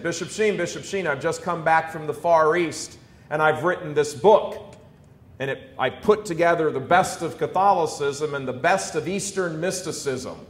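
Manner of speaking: ordinary delivery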